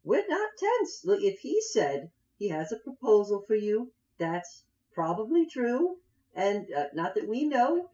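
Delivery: high, hurried and pleading; nervous halfling. Acted. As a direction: placate armed strangers nervously. Halfling placating the party.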